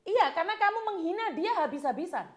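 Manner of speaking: real, forceful rebuke; indignant accusation. Real, not acted. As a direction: raise your voice and accuse him outright. Host confronts accused husband.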